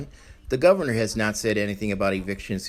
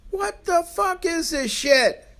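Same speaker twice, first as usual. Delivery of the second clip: outraged; slower than usual. Real, not acted. Outraged reaction to preacher.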